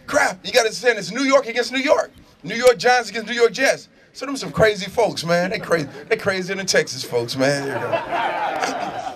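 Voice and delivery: ordinary delivery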